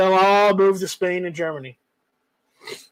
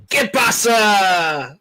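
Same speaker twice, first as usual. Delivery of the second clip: slow Spanish call; much slower than usual. Pretend. Greeting Jorge from the border, slow called-out Spanish. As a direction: stretch out "¿Qué pasa?" like calling across a street. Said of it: "all I have to ask is".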